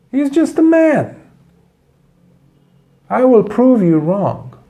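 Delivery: a sly man boasting he can provoke the monk; scheming Brahmin. Acted. Brahmin plots to anger the monk.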